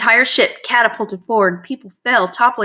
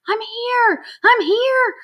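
faint voice; 13 semitones higher, slower than usual. A narrator's reading. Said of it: "George heard a faint voice".

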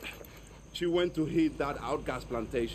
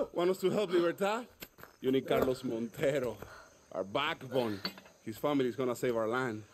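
normal talking voice

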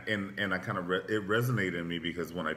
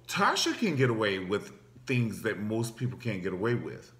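ordinary talk, nothing marked

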